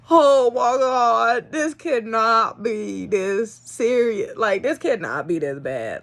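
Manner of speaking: slow, stretched-out dismay, sliding downward; drawn-out exasperation. Retold or imitated. Owner struggling to feed tiny puppies, drawn-out exasperation. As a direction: re-enact despair, dragging every word. Retelling despair while feeding puppies.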